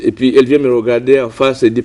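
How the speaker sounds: ordinary talk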